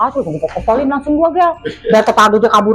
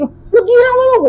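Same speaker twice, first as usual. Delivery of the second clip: higher, slow mock scolding; angry outburst; 10 semitones higher, much slower than usual. Scolding friends who rested while she struggled, retold anger. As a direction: drag out the insult in retold anger. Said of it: "gue marah-marah".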